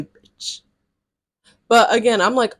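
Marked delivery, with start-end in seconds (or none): 0.0-0.6 a sly hushed jab tacked on the end; whispered aside. Real, not acted. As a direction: whisper the insult under your breath. Aside insult after her retort.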